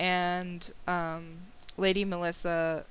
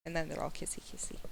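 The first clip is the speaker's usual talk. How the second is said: hushed aside; 2 semitones lower, 9 dB quieter, much faster than usual. Real, not acted. Whispered fast aside.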